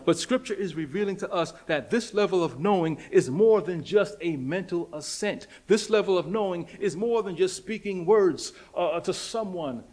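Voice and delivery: preacher driving the point home, fervent preaching. Acted. Preaching to his church on knowing God, fervent preaching.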